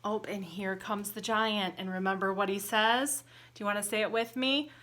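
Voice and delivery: ordinary delivery, nothing marked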